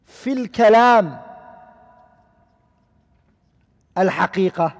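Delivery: each Arabic word drawn out slowly; slow, weighty recitation of a scholarly rule; slow recited maxim. Shown as a demonstration. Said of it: "they say".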